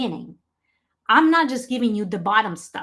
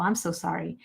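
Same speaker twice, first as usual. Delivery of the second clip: ordinary talk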